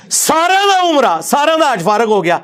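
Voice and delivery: high, slow, sing-song mockery of the tale; sarcastic mock lament, high and drawn out. Real, not acted. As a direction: drag it out as if ridiculing. Mocking the story's consequence.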